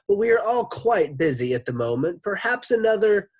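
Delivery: high, loud polite brush-off; put-on polite voice. A narrator's reading. Teacher fobs off the lunch lady, high put-on politeness. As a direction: put her off in a high fake-courteous voice. Teacher puts off visitor, stolen voice.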